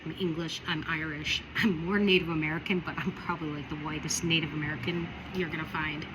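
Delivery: ordinary talk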